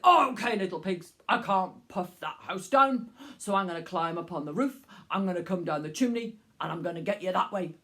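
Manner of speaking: low, fast wolf plotting; scheming wolf. Acted. Wolf plans the chimney.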